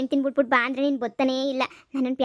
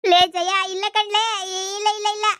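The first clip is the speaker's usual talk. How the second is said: high, whimpering denial; husband begging his wife, whining; panicked pleading; 5 semitones higher than usual. Acted. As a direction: beg her, near tears.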